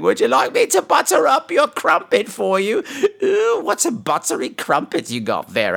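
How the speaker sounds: hammed-up British accent with laughter; mock British accent. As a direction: poke fun in silly British English. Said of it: "make fun of them like I do with all British people".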